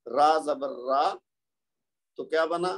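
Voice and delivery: normal talking voice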